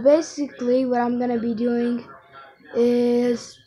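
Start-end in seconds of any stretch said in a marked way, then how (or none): 2.8-3.6 hiccups. Real, not acted. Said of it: "I hate the hiccups".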